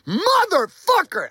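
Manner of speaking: high, slow, drawn-out yell of "Motherfucker"; freaking-out yell. Retold or imitated. Man splashed with toilet water, high drawn-out furious yell. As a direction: stretch the curse out in a high wail of rage. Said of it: "I'm freaking the fuck out".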